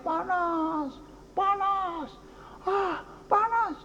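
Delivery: high, drawn-out wail of people in the heat; mock cry of suffering, pitched far up; imitated crying out. People under a scorching sun crying out, imitated high wail. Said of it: "orang teriak-teriak".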